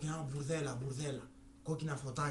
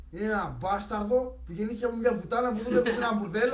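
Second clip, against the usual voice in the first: loud, higher ranting explanation; worked-up storyteller ranting loud and higher; heated rant; 6 semitones higher, 8 dB louder than usual. Real, not acted. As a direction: ramp up and rant it out. Teller's heated rant explaining joke.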